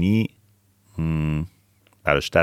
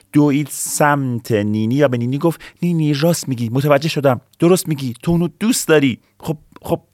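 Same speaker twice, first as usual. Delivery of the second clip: lively storytelling; 10 semitones higher, 5 dB louder than usual. Acted. Excited story dialogue.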